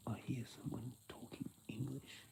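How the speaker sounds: hushed, unvoiced confiding; whispering. Real, not acted. Telling viewers about overhearing English, in a whisper. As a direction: whisper it like a secret.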